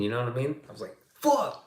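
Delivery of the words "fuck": high-pitched mock cry of pain; retold pained yell. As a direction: yelp the curse as if just hurt. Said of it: "I was in pain".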